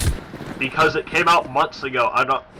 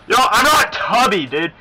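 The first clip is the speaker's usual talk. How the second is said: high, loud offended yelp; indignant protest; 7 semitones higher, 4 dB louder than usual. Real, not acted. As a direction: protest like you're insulted. Denying being called tubby.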